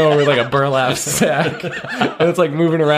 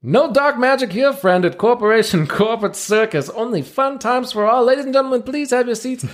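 showman ringmaster; 11 semitones higher than usual. Acted. Ringmaster presenting seals to the crowd, grand showman voice. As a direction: play the circus ringmaster working the crowd.

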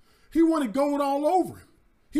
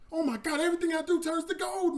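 thrilled shout, higher; excited; 7 semitones higher than usual. Acted. Midas discovers his golden touch, thrilled shout. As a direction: whoop with joy at the gold. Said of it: "He's so excited".

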